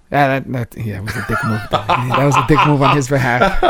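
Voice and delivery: amused, laughing verdict. Real, not acted. Host laughs agreeing the barber was rude. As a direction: say it through a chuckle.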